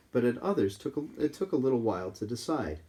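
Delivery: plain reading voice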